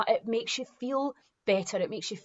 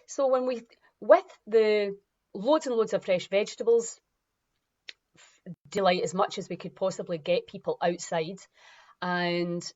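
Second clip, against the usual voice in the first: usual speaking voice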